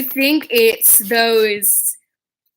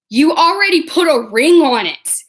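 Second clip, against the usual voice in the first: higher, louder acted objection; mock indignant protest; 5 semitones higher, 6 dB louder than usual. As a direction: imitate a jealous partner protesting loudly. Yuri protests to Victor, acted.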